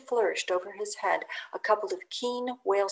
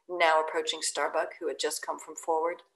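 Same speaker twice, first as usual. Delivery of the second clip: ordinary delivery, nothing marked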